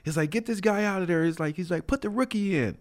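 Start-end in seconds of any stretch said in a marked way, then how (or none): 0.3-1.2 high, strained sideline order; mock coach voice, pitched way up without shouting; exasperated coach. Coach orders player off field. 1.9-2.8 high-pitched substitution order; mock coach voice, raised pitch at modest volume; exasperated coach.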